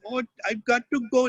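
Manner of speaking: high-pitched, exaggerated Indian accent; Indian dialect. Child voice actor showing off an Indian dialect in the booth, high exaggerated Indian accent. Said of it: "can do an Indian dialect".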